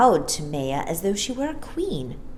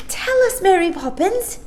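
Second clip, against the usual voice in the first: put-on eager child; 14 semitones higher, 4 dB louder than usual. Child Jane pleading.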